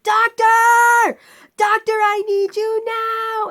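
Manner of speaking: high, very loud demanding holler; imitated yelling. Imitating the screamer patient calling for the doctor, yelling. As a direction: yell for attention, drawing it out. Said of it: "he's just yelling and yelling".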